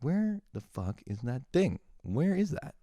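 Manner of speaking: asking the air. Shown as a demonstration. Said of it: "I ask the air".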